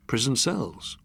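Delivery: plain reading voice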